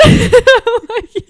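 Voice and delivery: loud high laugh; burst of laughter. Real, not acted. Cracking up at sister's shirt, loud laugh.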